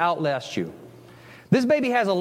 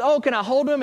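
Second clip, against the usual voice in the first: eager stranger; 6 semitones higher than usual. Retold or imitated. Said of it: "someone says".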